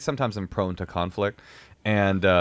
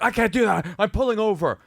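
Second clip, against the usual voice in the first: much higher whiny voice; mocking driver; 11 semitones higher than usual. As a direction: play the driver as a panicky whiner. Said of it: "he's like".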